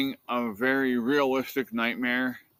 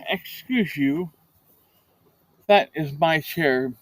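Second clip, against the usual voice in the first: mock stern scolding; 3 semitones higher, slower than usual. Real, not acted. Scolding the cat off her chair.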